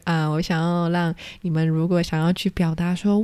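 ordinary delivery

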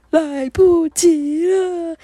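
loud, slow, high lament; retold despairing wail; mock wail. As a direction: draw it out as a hammed-up cry of regret. Crying inner lament at climax.

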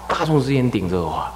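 normal talking voice

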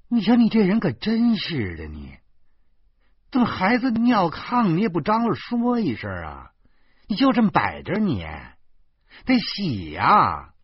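high, loud nagging wife; indignant wifely reproach; exasperated scolding. Acted. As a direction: tell him off in high-pitched exasperation. Wife scolds husband in quarrel.

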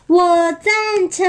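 high, drawn-out cheer of agreement; storybook all-in-favour cry; group cheer. Acted. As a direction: stretch it out high like a chorus. Whole pack cheers agreement in story.

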